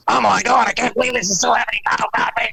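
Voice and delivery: high, fast, raspy whispered cartoon panic; Beavis and Butthead. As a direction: rattle it out in a raspy cartoon croak. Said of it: "be Beavis and Butthead going".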